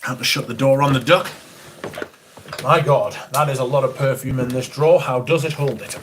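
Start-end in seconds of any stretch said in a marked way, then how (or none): none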